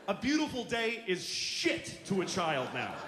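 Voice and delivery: usual speaking voice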